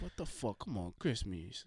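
put-on soft, slowing Spanish-accented plea. Barber friend urging a holiday drink, imitated Spanish accent. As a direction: wheedle quietly in a mimicked Spanish accent. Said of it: "this is a great accent".

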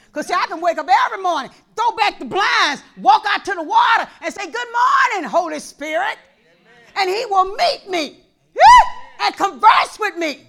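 high, loud preaching shout; full-force preaching. Acted. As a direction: preach it with everything.

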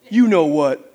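low, measured parrot comeback; dry, knowing comeback; deadpan punchline. Acted. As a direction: deliver it low after a long pause.

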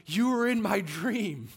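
loud, high, drawn-out recognition; acted-out amazement, stretching the words; amazed declaration. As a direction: proclaim it slowly, loud and high. Acting out a startled dreamer's greeting.

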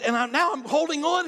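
high, loud cry of clinging on; acted fright. Skydiver clinging to the plane door, acted fright. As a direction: play the terror high and loud. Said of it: "I'm scared to death".

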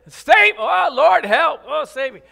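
loud, frantic cry for rescue; panicked plea. Shown as a demonstration. Preacher acts out Peter sinking, crying out loudly in panic. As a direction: yell for help in a panic.

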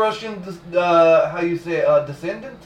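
halting mock Russian accent. Claiming Russian descent, mock Russian accent. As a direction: grope for the word like a Russian. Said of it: "Did not like either of your Russian accents".

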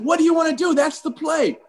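high-pitched anxious retold demand; urgent insistence. Player pressing coach for the call mid-game, urgent.

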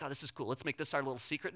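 low, hushed pretend confiding; mock secretive. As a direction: pretend to share a little secret. Mock couple keeping a secret.

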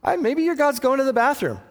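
hammed-up trash talk, way up high; preacher playing Elijah's bathroom jab, taunting; mocking taunt. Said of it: "what it's really saying there".

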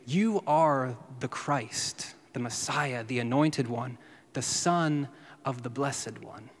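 slow, deliberate declaration; measured statement, slow; slow reading. Shown as a demonstration. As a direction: lay each title down slowly. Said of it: "Literally, it translates".

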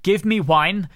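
mock Spanish accent; rubbish Spanish accent. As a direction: bark the order in a bad Spanish accent. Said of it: "that was a rubbish Spanish accent".

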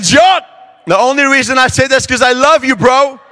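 high, loud, strained declaration of love; mock manly shout. Player pleading with angry teammate, loud mock-manly voice. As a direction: call it out with comic bravado. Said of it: "I said it with a real manly voice".

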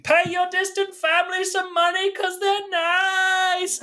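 very high cartoon squeak; squeaky silly voice. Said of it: "running out and saying".

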